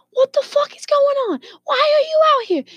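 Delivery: very high mock screech; high-pitched retold outrage; imitated screaming wife. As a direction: mimic a wife shrieking at her husband. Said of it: "it starts screaming and bawling".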